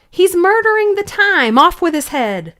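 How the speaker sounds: high, loud furious bawl; queen bawling. Acted. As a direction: bellow it like a raging queen. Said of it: "the Queen bawled out".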